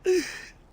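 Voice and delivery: loud high laugh; short, high-pitched real laugh; burst of laughter. Real, not acted. Player amused at friend's straight head, high laugh.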